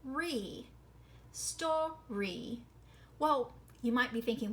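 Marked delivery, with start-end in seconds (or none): 0.0-2.6 "re" said ree, then "story" split as stor, ree; sounded-out syllables. Shown as a demonstration. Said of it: "the last syllable is".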